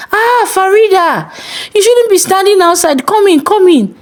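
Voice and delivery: put-on high, bright, coaxing older man; eager pastor voice. A narrator's reading. Pastor coaxing Farida into his empty house, high eager voice. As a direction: lure her in with cheer.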